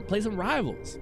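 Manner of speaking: ordinary talk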